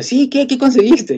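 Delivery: imitating a wife's high, pressing questions; eager questioning. Wife questions husband about the job he found, high and eager. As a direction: fire off high-pitched mock questions.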